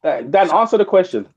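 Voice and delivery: hammering the same demand; insistent. Real, not acted. Pressing the co-host for an answer, insistent.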